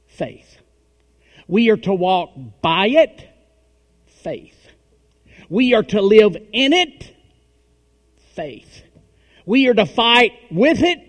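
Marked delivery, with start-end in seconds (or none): 1.5-3.2 loud rising preacher call; preaching at full force. Acted. Preacher rallying the congregation, loud call. 5.5-7.1 loud rising preacher call; preaching at full force. Acted. Preacher rallying the congregation, loud call. 9.5-11.0 loud rising preacher call; preaching at full force. Acted. Preacher rallying the congregation, loud call.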